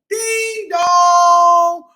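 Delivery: loud, high sung ding dong; sung doorbell. Acted. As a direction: sing it like a doorbell chime. Doorbell rung in story.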